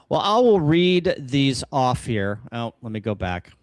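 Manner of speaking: normal talking voice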